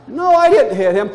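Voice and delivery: put-on protesting child. Six-year-old answering grandparent's accusation, loud protest.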